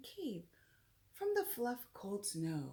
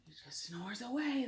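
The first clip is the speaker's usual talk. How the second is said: ordinary delivery, nothing marked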